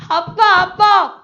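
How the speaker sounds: 높고 크게 아빠를 부름; 반가워서 높이 소리쳐 불러. Retold or imitated. 딸이 아버지를 부름. (친정에 온 딸이 아버지를 크게 부름)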